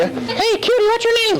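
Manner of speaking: very high flirty puppet with a laugh. Acted. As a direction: chirp a cheeky pickup line.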